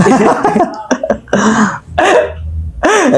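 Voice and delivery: high, soft drawn-out giggle; long giggle. Real, not acted. Giggling at made-up words in the twister. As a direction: giggle helplessly. Laughs after own fumble.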